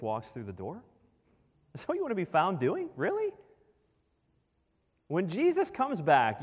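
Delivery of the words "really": a sharp, very high rising Really; as if you can't believe it; shocked disbelieving question; incredulous challenge. Real, not acted. Challenging the grumbling listener.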